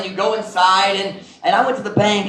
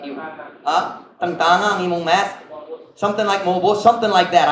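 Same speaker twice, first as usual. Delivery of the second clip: ordinary talk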